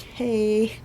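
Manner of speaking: ordinary delivery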